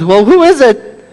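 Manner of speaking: high, loud, anxious question; crying out. A narrator's reading. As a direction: cry it out up to the stranger. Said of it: "the man cries".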